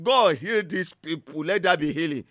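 demonstrating a pleading prayer. Shown as a demonstration. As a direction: beg God gently for healing. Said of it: "you are pleading".

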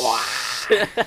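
high, loud robot-like squeal. Retold or imitated. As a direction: squeal it like a falling droid. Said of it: "she sounded like fucking R two D two".

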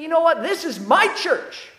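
possessive inner voice. Retold or imitated. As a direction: voice a proud, possessive thought. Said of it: "You can start thinking".

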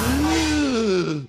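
big, loud, high-pitched mock gasp; dramatic gasp. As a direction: gasp in exaggerated shock at a theory. Mock shock at co-host's theory.

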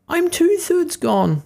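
high, slow, mournful little voice; gingerbread man voice. A narrator's reading. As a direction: wail it slowly like a tiny cookie. Gingerbread man being eaten.

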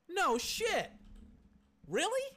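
sharp high burst of real surprise; can't believe the stat he just read; stunned disbelief. Real, not acted. Podcaster stunned by receiver's huge stat line, disbelieving. Stunned by Tyreek Hill's yardage.

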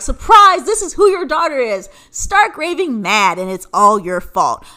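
loud, brash mock reveal; mock announcement. Daughter revealing herself to dad, mock-triumphant accusation.

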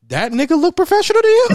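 high, loud mocking disbelief; almost shouting, are you kidding me; incredulous. To the girl about the scammer, loud and incredulous.

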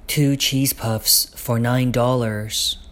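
ordinary delivery, nothing marked

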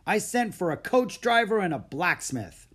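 growling. A narrator's reading. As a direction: grumble loudly, rejecting them outright. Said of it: "he growled".

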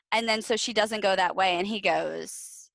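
ordinary talk, nothing marked

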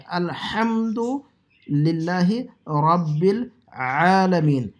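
very slow "الحمدللہ رب العالمین", pharyngeal h and ayn; correct throat letters. Shown as a demonstration. Teacher modelling correct Fatiha, slow throaty "الحمدللہ رب العالمین". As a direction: draw out "الحمدللہ رب العالمین" with strong throat sounds. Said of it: "سورۃ الفاتحہ صحیح کر لے".

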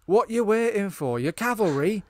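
high, loud, slow soldier's yell; loud mock war cry down a hill; retold battlefield shout. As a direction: bellow it at fleeing troops. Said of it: "and he shouts".

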